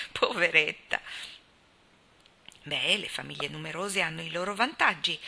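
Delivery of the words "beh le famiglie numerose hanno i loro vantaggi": usual speaking voice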